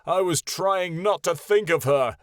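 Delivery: loud, raging reply; thundering. Acted. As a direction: keep the thunderous anger. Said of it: "he thundered".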